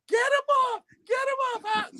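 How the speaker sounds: very high mock-panicked squeal; screaming like a baby. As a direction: mimic a grown man wailing like a baby. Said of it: "screaming like a baby".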